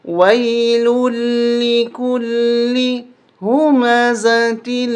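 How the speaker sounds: melodic Quran recitation. Shown as a demonstration. Teacher recites again for students to listen. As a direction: recite the verses flowing and chanted. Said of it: "دوبارہ سنیں".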